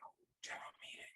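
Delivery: breathy mocking whisper, quick; sarcastic whisper. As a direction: whisper it like a private joke. Mocking the meeting announcement to himself.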